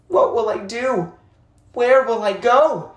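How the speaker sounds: put-on a lost boy asking himself in fear; desperate boy. Abandoned boy in despair.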